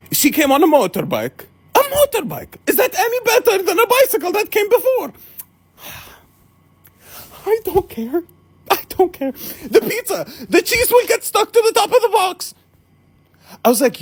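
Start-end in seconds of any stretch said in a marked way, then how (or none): 1.7-12.5 very high mocking voice; fussy objector. Pizza seller objecting to the motorbike, mock fussy. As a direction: imitate a fussy refusal in a squeaky pitch.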